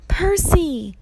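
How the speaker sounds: high, loud call of a name; urgent call. A narrator's reading. Annabeth startles Percy, calling his name urgently. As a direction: call sharply for attention.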